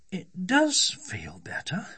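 high, slow, loud wonder; drawn-out pleasant astonishment; surprised. A narrator's reading. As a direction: discover it with surprise. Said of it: "in a tone of surprise".

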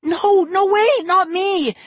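desperate to clear his name; scream. A narrator's reading. Ian screams his denial at his sister, high and loud. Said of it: "Ian screamed".

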